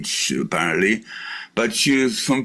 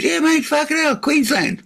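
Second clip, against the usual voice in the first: much higher squealing hammed-up Australian; exaggerated Aussie. Man answering a caller, much higher mock Australian. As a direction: shriek it like a caricature Queenslander. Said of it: "I said".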